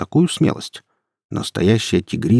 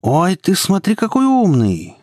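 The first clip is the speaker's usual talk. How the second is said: high, drawn-out sneer; mocking thug, voice pitched way up; laughing mockery; 11 semitones higher, 4 dB louder, slower than usual. A narrator's reading. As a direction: jeer at a know-it-all, stretching the end. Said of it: "рассмеялся Андрей".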